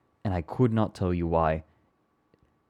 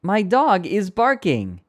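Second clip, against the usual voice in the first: high, slow robotic TikTok narrator voice; imitating the TikTok voice; TikTok text-to-speech voice; 10 semitones higher, 4 dB louder, slower than usual. Shown as a demonstration. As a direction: another try at the TikTok voice. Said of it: "I can't quite get the inflection right".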